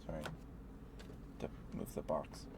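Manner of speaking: ordinary talk, nothing marked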